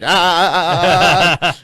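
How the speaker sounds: loud rhythmic straining ahs; mock labor pushes; straining grunts. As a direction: grunt it out like giving birth. Mimicking straining on the toilet.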